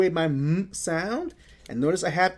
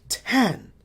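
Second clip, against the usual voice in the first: normal talking voice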